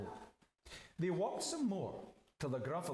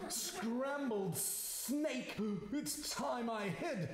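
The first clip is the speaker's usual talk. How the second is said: high, drawn-out "Scrambled snake?" in alarm; 7 semitones higher, slower than usual. Acted. Snake takes fright.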